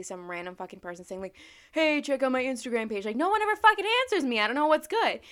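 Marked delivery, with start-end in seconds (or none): 1.7-3.0 lightly voiced online spammer, higher and louder; a hint of pushy plugging, pitched up; mock self-promoter. Random person plugging their page, lightly mocked self-promoter. 3.0-5.2 high, loud, rapid venting; exasperated complaint. Real, not acted. Vlogger ranting that nobody answers her, exasperated.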